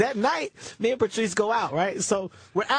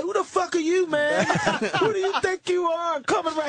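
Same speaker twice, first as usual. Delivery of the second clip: high, mock-angry comics; heckled comic snapping back; 9 semitones higher than usual. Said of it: "These guys get mad".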